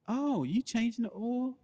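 high, slow feminine voice; mock-sweet high woman's voice; high lady voice. Voicing his lady.

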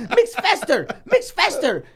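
high, loud mock Russian boss lady; the Russian ladies hurry the workers, in a high, loud mock Russian accent. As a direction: bark it like a pushy Russian supervisor. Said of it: "the Russian ladies would walk around going".

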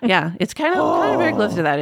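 ordinary talk

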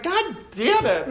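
high, slow exasperated groan; hammed-up annoyance; mock exasperation. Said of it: "I'm like".

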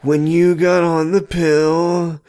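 high, slow mock plea; slow, high hammed-up Grant. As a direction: drag it out like an awkward boy. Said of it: "he's like".

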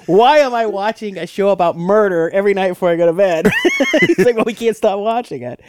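fawning gush. Retold or imitated. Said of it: "he's just fawning".

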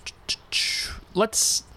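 ordinary delivery

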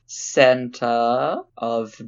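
very slow, drawn-out; slow deadpan. Pretend. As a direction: spin it out slowly. Slowly inventing a joke name.

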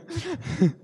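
a sudden high-pitched laugh; high burst of laughter. Real, not acted. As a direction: laugh out high, surprised at yourself. Laughing while telling the story.

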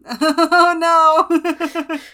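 loud laughing protest. Real, not acted. Reacting to the maximum weasel joke, laughing loudly. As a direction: laugh out your horror.

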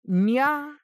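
high cartoon cat nya; cutesy nya speak. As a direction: chirp it like a kitten. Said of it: "so the cat goes".

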